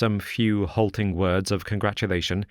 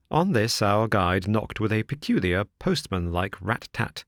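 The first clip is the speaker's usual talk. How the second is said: plain reading voice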